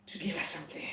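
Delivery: whispered and breathy, no voice. Shown as a demonstration. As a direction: repeat it as a secret whisper. Repeat of the same words, whispered.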